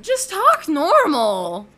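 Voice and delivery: high, slow exasperated plea; hammed-up high-pitched complaint; mock exasperation. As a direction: stretch it out, fed up and pleading. Mock complaint about people's accents.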